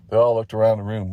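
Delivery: ordinary delivery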